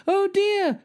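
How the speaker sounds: extremely high squeal of dismay; hammed-up high cry. A narrator's reading. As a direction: squeal it like a distressed storybook wife. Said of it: "she cried with spoon in hand".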